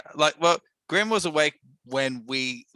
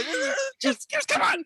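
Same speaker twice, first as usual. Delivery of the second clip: panicking; slower than usual. Acted. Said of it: "I start panicking".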